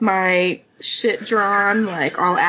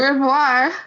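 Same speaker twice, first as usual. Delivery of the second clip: mock French farewell; 4 semitones higher than usual. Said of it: "That's what French people sound like".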